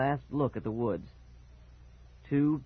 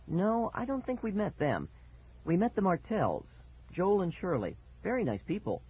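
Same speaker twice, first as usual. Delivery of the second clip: lightly voiced mother, higher pitch; mom; 8 semitones higher than usual. A narrator's reading. Mom chatting to son about the neighbors, lightly voiced higher.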